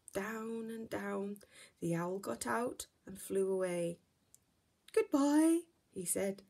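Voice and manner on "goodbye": much higher, louder hooting owl. Acted. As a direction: hoot it like a friendly owl. Owl says goodbye.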